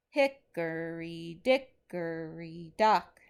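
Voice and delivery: slow, heavily stressed reading, each beat marked; slow rhythmic dictation. Shown as a demonstration. Teacher dictating a nursery rhyme for students to mark stresses. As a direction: read it out slowly so students can mark the beats. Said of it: "let's go ahead and practice on this one".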